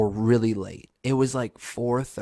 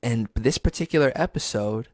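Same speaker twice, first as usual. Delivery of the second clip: ordinary talk, nothing marked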